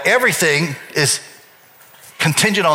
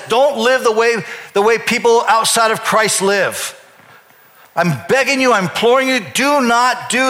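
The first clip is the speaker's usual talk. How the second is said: urgent preaching plea; 7 semitones higher than usual. Acted. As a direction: beg them like Paul imploring his church. Preacher voicing Paul's urgent plea.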